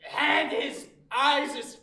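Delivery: high, loud shouted curse, broken into bursts; furious cursing. Acted. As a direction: rage at the opponent piece by piece. Cursing her dog in the quarrel.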